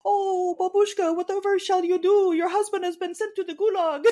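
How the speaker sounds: high, put-on babushka voice; squeaky mock Russian grandma; old Russian granny. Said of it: "Apprentice Two will put the blanket on them and go".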